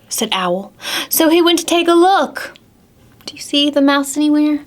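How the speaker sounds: plain reading voice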